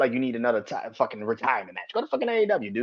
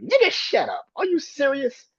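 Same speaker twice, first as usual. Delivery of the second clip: much higher, fed-up disbelief; exasperated high retort; 13 semitones higher than usual. Real, not acted. Mocking online fans, then a high exasperated shut up. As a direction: throw it up high, can't believe them.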